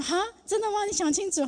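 high, incredulous "really?"; surprised disbelief. Retold or imitated. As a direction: ask it half-laughing, startled. Her surprise at husband's answer. (惊讶地反问)

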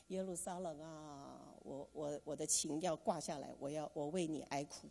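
mournful lament. Acted. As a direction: lament over Jerusalem quietly. Voicing Nehemiah's lament. (轻声缓慢的哀叹)

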